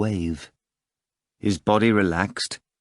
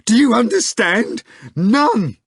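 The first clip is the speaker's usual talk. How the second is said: desperate cry; 14 semitones higher, 7 dB louder than usual. Acted. Nikanor continues his terrible cry.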